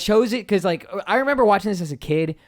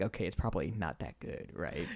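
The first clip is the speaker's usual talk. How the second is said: much softer and lower, half to himself; quiet inner doubt; 9 semitones lower, 15 dB quieter than usual. Retold or imitated. Remembering his doubt about the show, much softer and lower. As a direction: murmur it as a private doubt.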